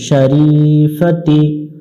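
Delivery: very slow শরীফাতি, রী stretched; drawn-out শরীফাতি; long vowel. Shown as a demonstration. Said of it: "এক আলিফ টান দিব".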